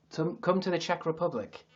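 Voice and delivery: usual speaking voice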